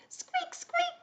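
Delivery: tiny, high mouse squeaking; mouse squeaks. Pretend. Teacher shows children the mouse cue, tiny high squeaks. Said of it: "when you hear mouse".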